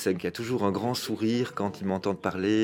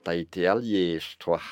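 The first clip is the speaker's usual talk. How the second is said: Brussels friend; 3 semitones lower than usual. Retold or imitated. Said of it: "ici, c'est".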